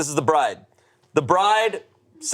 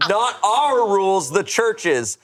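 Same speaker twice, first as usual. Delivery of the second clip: usual speaking voice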